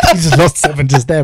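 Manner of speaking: loud laugh into a teasing line. Real, not acted. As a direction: laugh through the words. Laughing at friend's joke.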